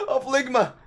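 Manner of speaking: high, loud meme shout; mock-dramatic punchline. Vlogger finishing the Ninja death joke, mock dramatic. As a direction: cry it out in fake anguish.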